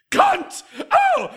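very high, loud blurted shriek; shrieked curse. Acted. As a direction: yelp it out like it escaped you.

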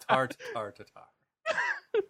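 very slow mock Irish; Irish accent. Shown as a demonstration. Co-host echoing the Irish impression, drawn-out Irish accent. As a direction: stretch out each word in an Irish brogue. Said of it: "that's where you hone in on it".

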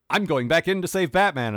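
bright eager declaration; bounding keen hero line. Retold or imitated. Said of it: "college girl just bounds and says".

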